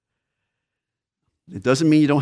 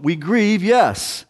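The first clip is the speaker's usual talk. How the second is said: rising affirmation; 6 semitones higher, much slower than usual. Real, not acted. Preacher insisting to congregation that believers grieve, lifted up. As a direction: lift the voice on "yes".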